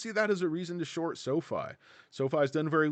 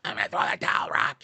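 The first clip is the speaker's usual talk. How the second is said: a lower, gruff-sounding trainer voice, lightly done; hint of an old boxing trainer talking to Rocky; corner man; 5 semitones lower, 5 dB louder, faster than usual.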